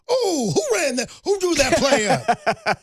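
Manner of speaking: high, slow mock Samuel L. Jackson coach; Coach Carter impression. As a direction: ham up the stunned coach, drawn out. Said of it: "Coach Carter goes".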